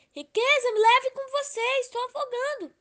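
high, loud begging; high pleading voice; desperate plea. Acted. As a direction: plead with Wealth as if drowning. Love begging Wealth from water.

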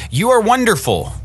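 storyteller's contained shout of praise; mild mock shout. A narrator's reading. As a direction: call it out high as the father. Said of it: "Then my father shouted".

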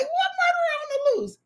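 high-pitched incredulous question; mock alarm. Retelling her reply to mother's news, high mock alarm. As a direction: send it way up high in disbelief.

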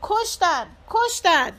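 high, loud cry of alarm; shouted alarm. A narrator's reading. Bystander alerting the crowd to the killing, loud alarmed shout. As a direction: yell it to the whole street. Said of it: "یکی داد زد".

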